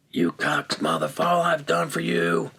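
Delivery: high-pitched mock tirade; retold screaming in a strained high voice; imitated angry yelling. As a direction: imitate a furious caller ranting down the phone. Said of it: "he's yelling and screaming".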